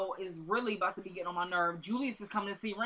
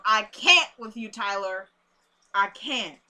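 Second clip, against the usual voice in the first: exasperated; 9 dB louder, slower than usual. Real, not acted. Fed up with the show's creator.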